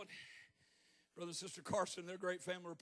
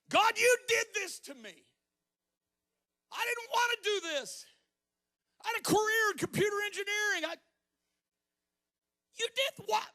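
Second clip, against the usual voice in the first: angry outburst at God; 10 dB louder than usual. Retold or imitated. Retelling how he railed at God in frustration, furious shout. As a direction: yell your grievance straight at heaven. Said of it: "I'm just like".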